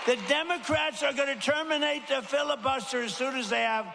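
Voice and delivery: rising rally shout. Real, not acted. Rally warning about Democrats.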